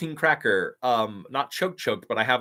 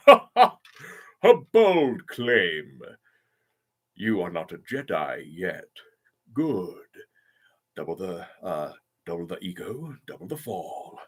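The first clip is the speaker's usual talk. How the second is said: Count Dooku; 5 semitones lower, slower than usual. Shown as a demonstration. Streamer doing a requested villain for a fan, low and deliberate. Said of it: "can you do Count Dooku's voice?".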